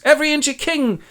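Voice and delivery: high, grand declamation; mad king boasting. Acted. Worn-down Lear proclaims his kingship, high and grand.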